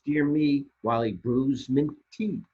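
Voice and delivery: plain reading voice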